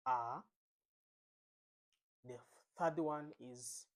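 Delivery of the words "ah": Mandarin second tone on AH, sliding upward. Shown as a demonstration. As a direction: let AH climb like a question. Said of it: "You rise up".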